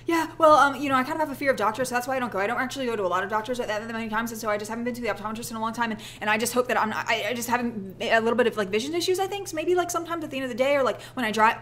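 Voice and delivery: nervous, fast babble; rambling fast. Retold or imitated. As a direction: rush the excuses out in one breath. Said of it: "talking a thousand miles a minute".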